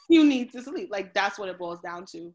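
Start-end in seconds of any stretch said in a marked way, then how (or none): 0.0-0.9 laughing. Real, not acted. Repeating the doctor's line, laughing.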